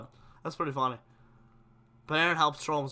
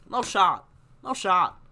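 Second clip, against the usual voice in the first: worked up; 2 semitones higher, 6 dB louder, slower than usual. Real, not acted. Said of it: "Now I'm getting worked up".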